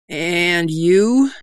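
shaky, nervous question; quavering. A narrator's reading. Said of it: "Doll quavered".

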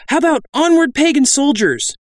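very high, loud eager suggestion; another bandit. A narrator's reading. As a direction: pipe up in a high voice. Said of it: "a different voice asked".

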